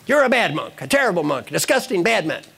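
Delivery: acted indignant telling-off; angry monk. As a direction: scold him in mock outrage. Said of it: "The other monk is angry".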